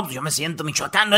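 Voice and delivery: lightly imitated Cantinflas; a quiet hint of Cantinflas. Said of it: "Él dijo".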